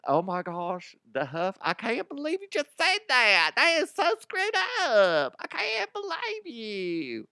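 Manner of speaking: mock a horrified listener clutching pearls; shocked listener impression. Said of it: "a lot of you are like".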